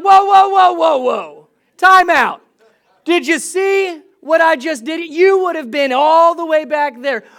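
high, loud hammed-up driver yelling whoa; exaggerated road rage for laughs; mock outraged shout. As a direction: play the offended driver shouting at a rude car. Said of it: "I get flustered and frustrated".

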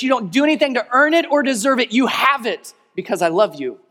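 high, loud, impassioned preaching; full-force preaching. Acted. Pastor preaching grace to the congregation.

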